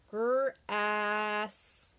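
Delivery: Grass drawn out so the short ah is heard; sound it out slowly. Shown as a demonstration. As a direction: stretch Grass for listening.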